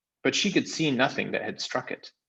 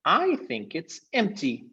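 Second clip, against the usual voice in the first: put-on higher, slower boy's voice; a boy guessing aloud; Eustace; 9 semitones higher, slower than usual. Eustace telling the others, higher and slower.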